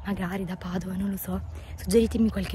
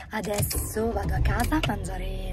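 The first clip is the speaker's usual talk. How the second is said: normal talking voice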